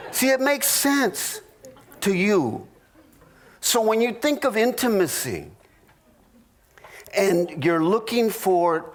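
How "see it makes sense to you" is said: mock wife. Wife's answer in mock argument.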